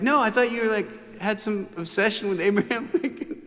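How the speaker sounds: high, lightly voiced mom; mom insisting. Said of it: "but she was like".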